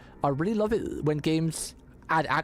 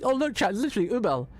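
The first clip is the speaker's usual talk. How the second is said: higher-pitched re-enacted exclamation; retold excited reaction; 7 semitones higher than usual. Streamer retells telling chat about a lookalike character, excited. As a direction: mimic your first excited call-out to chat. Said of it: "I was like".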